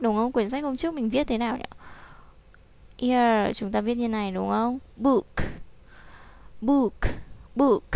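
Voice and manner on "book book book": "Book." with a clear final k; "Book." ending crisply on k; final k sound. Shown as a demonstration. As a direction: land the k at the end of "Book.". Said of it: "Nhớ âm k nhá".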